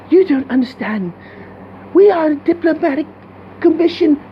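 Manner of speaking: high, proper mock British accent. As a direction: play a prim British princess. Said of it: "they speaking as British".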